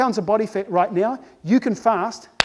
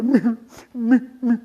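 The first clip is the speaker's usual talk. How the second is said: small mewling bleats, a little raised; sick animal whimper. Acted. Speaker imitating sick animals lying down, feeble mewling. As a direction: mewl like a poorly animal. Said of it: "do they lie there and go".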